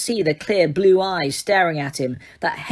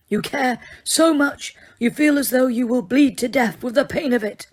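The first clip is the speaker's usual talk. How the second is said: calm; 7 semitones higher than usual. A narrator's reading. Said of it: "His expression was calm".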